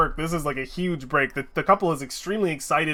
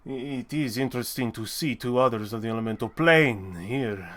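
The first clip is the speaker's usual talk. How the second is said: lower, halting, measured voice; reserved forge master; 5 semitones lower than usual. Acted. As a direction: speak low and haltingly, with long hesitations. Game master voices the smith.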